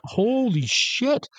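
high, slow, drawn-out mock shock; stunned disbelief. Narrator reacting to death threats, slow high astonishment. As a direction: let the disbelief stretch out high.

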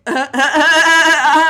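loud stuttered "uh, uh, uh" in mock panic; panicked stammer. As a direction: imitate yourself flustered by tears. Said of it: "And I just go".

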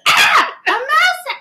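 high, loud startled cry; startled shriek. Retold or imitated. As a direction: shriek it out in fright. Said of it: "I was like".

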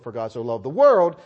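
"world" punched loud at the end; heavy emphasis on final "world"; stress on world. Shown as a demonstration. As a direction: save the weight for the word "world". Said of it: "I'll read it like this".

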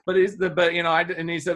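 ordinary talk, nothing marked

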